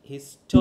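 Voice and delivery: Histo- with টো punched loud; stress on second syllable. Shown as a demonstration. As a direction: hit টো hard in histo-. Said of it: "second সিলেবলের উপর আছে". (হিস্টো-র টো-তে জোর দিয়ে)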